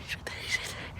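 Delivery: breathless unvoiced whisper. Real, not acted. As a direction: keep it hushed while hiding. Hiding near opponent.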